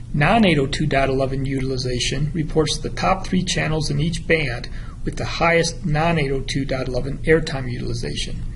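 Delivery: ordinary talk, nothing marked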